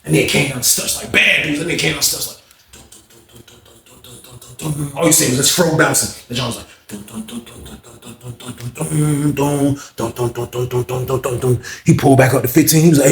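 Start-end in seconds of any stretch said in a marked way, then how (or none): 2.7-11.6 mouthed walk-in music; hummed theme. Retold or imitated. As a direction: hum a boss entrance theme.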